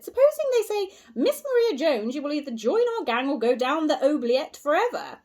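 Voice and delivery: high, loud hammed-up gangster threat; mock villain. Susan teasing the others, mock gangster threat. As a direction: playfully ham up a melodrama villain. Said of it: "it's rather sport".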